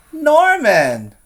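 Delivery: sudden high loud "Norman!"; surprised reveal. A narrator's reading. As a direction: call out the name in surprise. Exclaimed reveal in story.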